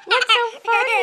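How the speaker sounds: high loud laughing question. Real, not acted. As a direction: ask it through big laughs. Parent laughing during tickling.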